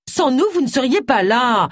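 mildly indignant character voice; protesting. A narrator's reading. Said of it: "protesta Agatha".

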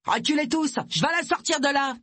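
high, forceful shout; barked order. A narrator's reading. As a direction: bark at everyone to clear the way. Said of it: "Charlie Beagle aboya".

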